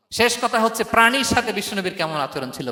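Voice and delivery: normal talking voice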